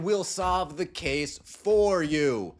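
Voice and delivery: slow, pointed mockery; slow, deliberate. Real, not acted. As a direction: drag out each word of 'We'll solve the case for you'. Mocking the league's investigation.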